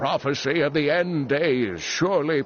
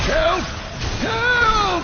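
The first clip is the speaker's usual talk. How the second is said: calling for help; 3 dB louder than usual. Acted. Trapped scholar calls for rescue.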